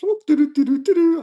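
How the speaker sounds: high, light doo-doo-doo; carefree sing-song. Retold or imitated. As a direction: imitate yourself idly dawdling along. Acting out lollygagging through the run.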